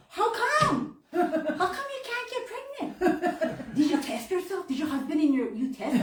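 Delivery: hammed-up loud busybody, laughing through it; loud nosy cashier. Imitating the cashier loudly quizzing her in line, hammed-up loud. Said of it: "says it really loud".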